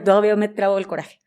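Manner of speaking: normal talking voice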